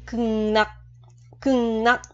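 "Cưng nắc" with k read as ng and r as n; sound-change demo. Shown as a demonstration. Teacher shows learners the Korean sound rule, nasalised demo. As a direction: model "Cưng nắc" slowly, holding the nasal ng into n. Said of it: "cực mà mình đọc là cưng".

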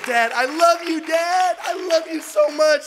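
high, loud cry of love; emotional shout. Retold or imitated. A son calling his dad, shouting his love. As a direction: call it out with everything. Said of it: "and I said".

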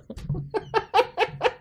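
sudden high squeal of a laugh; high burst of laughter. Real, not acted. Streamer cracking up at an unexpected page. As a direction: laugh out high, caught completely off guard.

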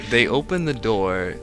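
ordinary delivery

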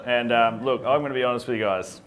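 ordinary delivery

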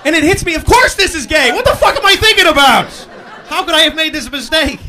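high, loud horrified outburst; panicked realization. Real, not acted. Realizing mid-kiss in the dream, high loud panic. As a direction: snap awake to the horror.

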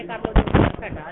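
loud mock defiance, imitating troublemakers; rowdy taunt. Retelling the accused men's village boasts, rowdy loud taunt. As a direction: throw out a loud imitated dare. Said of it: "ਹੁੱਲੜਬਾਜ਼ੀ ਕਰ ਰਹੇ".

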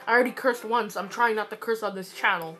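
ordinary talk

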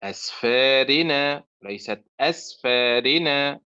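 أسفارنا then أسفارنا, slow, wrong one cut after أسفى; teacher showing the error at the word start; slow contrast demo. Shown as a demonstration. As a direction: draw out both readings of أسفارنا. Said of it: "أسفى فعل وليست أسفى فعل".

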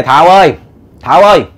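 narrator's light calling voice. A narrator's reading. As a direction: call over to the neighbour, lightly acted. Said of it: "Đi qua bên nhà ông kêu".

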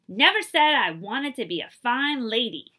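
loud, whiny complaint; complaining. Acted. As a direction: grumble it loudly, fed up. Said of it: "she complained to Buddy".

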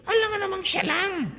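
mock the self-righteous spouse; high sneering tease; mocking sarcastic voice. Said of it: "Sabihin nyo nga sa katabi nyo yan".

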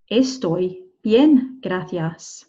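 "Estoy bien, gracias" with gaps between words; drawn-out "Estoy bien, gracias" for learners; slow word-by-word model. Shown as a demonstration. Teacher repeats slowly, word by word.

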